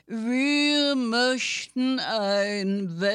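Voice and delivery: slow, drawn-out snail objection; lightly voiced slow snail speaking up. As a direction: drag it out like a snail. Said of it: "meldeten sich die Schnecken".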